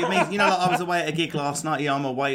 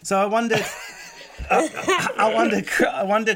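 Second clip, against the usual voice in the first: laughing through words; 9 semitones higher, 5 dB louder than usual. Real, not acted. Laughing at own impression.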